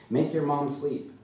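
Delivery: put-on high little-kid command; impatient toddler. A narrator's reading. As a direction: order a playmate impatiently. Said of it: "Tater gets impatient and says".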